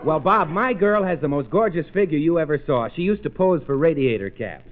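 ordinary talk, nothing marked

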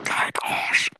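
secret unvoiced whisper. Real, not acted. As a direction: breathe it so nobody hears. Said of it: "das darf niemand hören".

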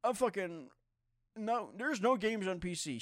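high-pitched mocking imitation; high, whiny mock complaint; mocking Cool Me. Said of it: "he's always like".

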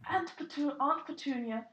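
normal talking voice

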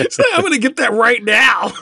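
high-pitched mock excitement; excited retold exclamation. Friend sold on the cheese nachos idea, excitedly. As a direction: exclaim it high, acting out someone eager. Acting her excited reaction.

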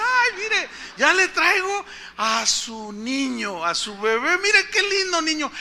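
high, drawn-out cheerful presenting voice; delighted nurse. Retold or imitated. Nurse bringing the baby to its mother, delighted. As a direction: coo over the baby, imitating a happy nurse. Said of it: "Bien contento".

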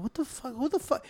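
quietly freaked out by the stranger's weird vibes; muttered disbelief. Retold or imitated. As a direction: say it to yourself so he can't hear. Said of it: "and I was like".

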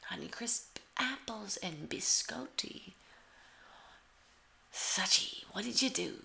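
normal talking voice

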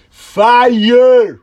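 high, drawn-out imitated yell; loud put-on shout, far above normal pitch. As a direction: holler it like an excited moviegoer. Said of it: "the guy to just yell out".

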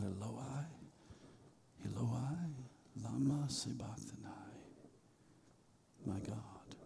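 a dying cry, whispered; faint cry. Acted. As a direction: let the cry fade into a whisper. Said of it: "Jesus cries out".

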